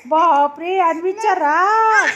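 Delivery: loud, slow hammed-up oh my; mock alarm. Grandmother loudly hamming up shock at the toddler's tantrum. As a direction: play up the child's anger, loud and stretched.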